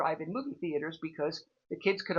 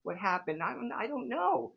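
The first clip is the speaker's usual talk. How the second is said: flustered mother; 10 semitones higher than usual. Retold or imitated. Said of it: "my poor mother was just like".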